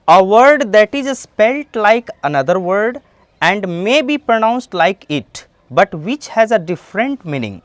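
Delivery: very slow, word by word definition; slow careful classroom dictation; slow dictionary reading. Shown as a demonstration. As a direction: spell out the meaning slowly for students. Reads English definition aloud.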